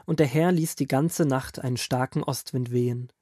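ordinary delivery

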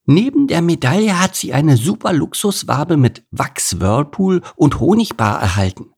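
a small bee proudly reporting the news; lightly voiced cheerful bee; proud little bee. A narrator's reading. Another bee boasting.

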